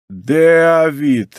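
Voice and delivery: first syllable of Давид drawn out as «Дэа-вид»; растянутый первый слог. A narrator's reading. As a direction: stretch Давид into a long «Дэ-а-вид», a glided to э-а. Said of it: "растягивая первый слог". («Давид» протяжно, с «эа» вместо «а» в первом слоге)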